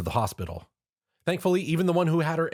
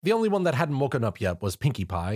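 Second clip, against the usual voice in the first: usual speaking voice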